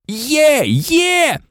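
two high whoops of triumph; shrieked cheer. A narrator's reading. Ron tugging Harry's arm after the win, shrieking. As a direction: squeal each cheer out high. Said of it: "верещав Рон".

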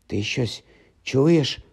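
hushed, slow question; whispering. A narrator's reading. Ron to Harry in the passage, whispering. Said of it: "прошепотів Рон".

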